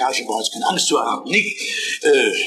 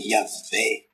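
soft reverent Yahweh; 6 semitones lower, 6 dB quieter than usual. Shown as a demonstration. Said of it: "klingt ... herrlicher".

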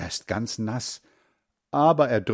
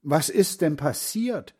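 higher-pitched, mildly startled question; understated narrated exclamation; exclaimed question; 7 semitones higher than usual. A narrator's reading. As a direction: ask in alarmed surprise. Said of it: "Sie ruft".

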